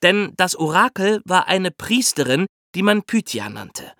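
normal talking voice

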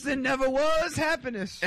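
slow and high, cracking up; laughing at his friend's outrage. Real, not acted. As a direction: laugh through the punchline, slowly. Laughing punchline.

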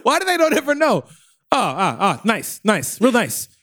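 high, needling New York voice; New York construction worker. Pretend. Worker sizing up the podcasters, needling New Yorker. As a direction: needle them like a worker unimpressed. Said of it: "turned into a New York construction worker".

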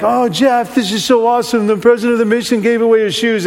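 high, gushing imitation of admirers; excited staff. Staff greeting Jeff back in the van, imitated excitement. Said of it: "my staff is all excited".

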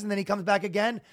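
normal talking voice

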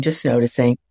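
ordinary talk, nothing marked

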